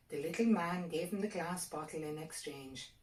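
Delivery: ordinary delivery, nothing marked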